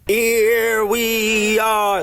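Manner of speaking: long high drawn-out sing-out; game title call. Retold or imitated. Vlogger imitating the ears' game title, high drawn-out call. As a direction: stretch each word out high and loud-ish. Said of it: "That's why it's called "Here we are".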